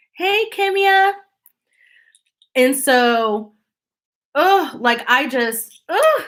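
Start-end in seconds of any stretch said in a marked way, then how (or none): 0.1-1.1 sing-song greeting. Real, not acted. Greeting someone, high and slow. 5.9-6.2 high exasperated ugh. Real, not acted. Exasperated noise.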